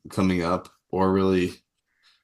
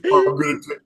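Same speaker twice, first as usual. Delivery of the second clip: squeaky, much higher and louder mock voice; mocking incel voice; 5 dB louder than usual. Mocking incels at the rallies in a squeaky high voice. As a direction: squeal it like a silly caricature. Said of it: "They're like".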